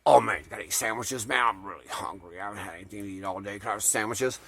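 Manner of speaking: low, soft boozy moocher mumble; Papa Jimmy impression. Moocher eyeing a cooler in a car, low boozy begging. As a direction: imitate a drunken wrestler pleading, low and soft. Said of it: "try to do an imitation of Jimmy's voice".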